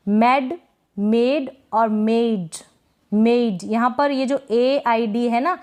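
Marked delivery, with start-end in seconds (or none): 1.0-2.6 मेड और मेड drawn out very slowly, each vowel long; teacher repeats मेड और मेड slowly for learners; slow contrast demo. Shown as a demonstration. Drawn out teaching demo. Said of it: "बोलेंगे तो आपको पता चलेगा".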